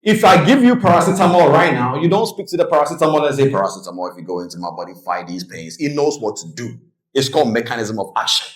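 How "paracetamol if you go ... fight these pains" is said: low, soft pretend command; mock pill instructions. Speaker pretends to instruct paracetamol, low mock command.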